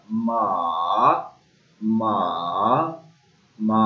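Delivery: मा dipping down and back up; third tone ma. Shown as a demonstration. Teacher showing the dipping third tone. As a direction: dip मा then lift it. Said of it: "up to down आएगा और फिर down से up".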